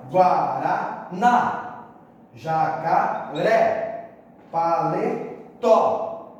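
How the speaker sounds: "guaraná, jacaré, paletó" slowly split, each final syllable punched; slow "guaraná, jacaré, paletó", hard final stress on each; stress on last syllable. Shown as a demonstration. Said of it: "Toda palavra oxítona terminada em A".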